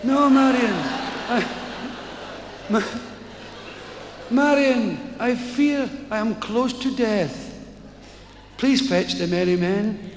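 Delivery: a hint of a frail old man at death's door; feeble dying man. Dying mayor begs Marion to fetch his men, slow and feeble.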